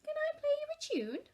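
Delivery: put-on very high eager child; child's voice. A narrator's reading. Lester asking Mum in the bath, high eager child. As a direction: ask sweetly like a small boy.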